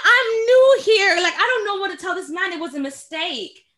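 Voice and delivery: high, loud wail easing off; retold panic. New salesperson panicking over an angry caller, hammed-up wail. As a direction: plead in a hammed-up, near-crying panic. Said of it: "my heart was just shaking".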